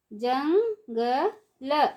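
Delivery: Jungle stretched slowly, syllable by syllable; slow syllable drill. Shown as a demonstration. Teacher drawing out jungle slowly for children. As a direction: draw out jungle for the class. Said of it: "ऊंची आवाज़ में कैसे पढ़ेंगे".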